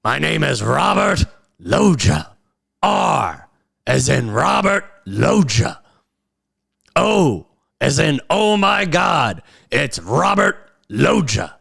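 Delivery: retelling a Family Guy bit; Robert Loggia impression. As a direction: spell it out like a proud old movie star on the phone. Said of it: "whoever the impersonator is".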